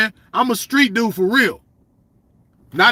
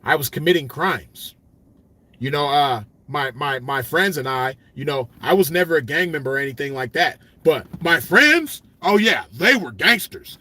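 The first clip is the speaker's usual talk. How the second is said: mocking a lame poser; 6 semitones lower than usual. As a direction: parody a square bragging about crime. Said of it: "you ain't gonna hear me say shit like".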